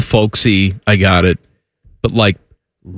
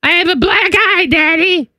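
very high, small hurt daughter voice; little girl voice. Retold or imitated. A daughter telling her father, high little-girl voice.

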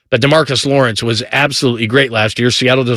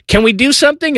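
wife's plea; 13 semitones higher than usual. Retold or imitated. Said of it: "she looks at me and goes".

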